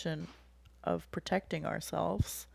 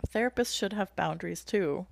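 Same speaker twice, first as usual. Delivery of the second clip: normal talking voice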